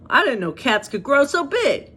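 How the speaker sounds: lightly voiced surprised dog; Dodger's voice. Dodger amazed at the bear cub, lightly voiced. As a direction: marvel at the size of the cub. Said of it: "that's how I'm envisioning Dodger sounding".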